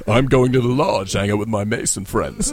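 puffed-up parody of a friend's grand way of talking; Thor-like boast. Retold or imitated. Mocking how Andrew hears himself, mock-mighty. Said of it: "he talks like Thor".

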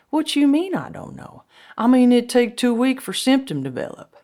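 high, loud back-and-forth bickering; high-pitched insistent protest; acted arguing. Acted quarrel over the virus.

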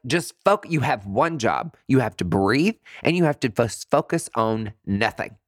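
normal talking voice

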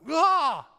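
sudden high yelp of anger; retold angry outburst. Preacher retelling his own anger, sharp high yelp. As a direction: let out a sharp mock cry of rage. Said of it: "I'm like".

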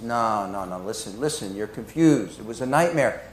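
low, soft reassurance; calm her down gently; soothing brother. Retold or imitated. Brother soothing his frightened sister, low and soft.

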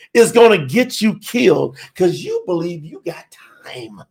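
normal talking voice

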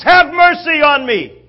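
high, very loud desperate plea; top of his lungs. Acted. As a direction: yell for mercy over the crowd's noise. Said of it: "begins at the top of his lungs".

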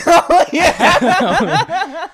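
bursting into high laughter; cracking up while agreeing; laughing. Real, not acted. Remembering the foot stomp.

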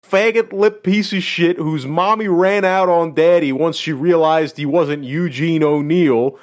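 loud, high mock tirade; bitching out. Reading the band teacher's insults to a student, mock-angry tirade. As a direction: read it as a teacher's scathing dressing-down. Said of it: "he's bitching this kid out".

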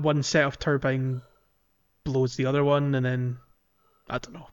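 ordinary talk

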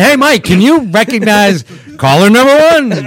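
loud, bright host announcing the caller; game show host. Acted.

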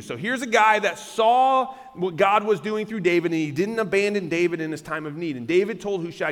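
ordinary delivery

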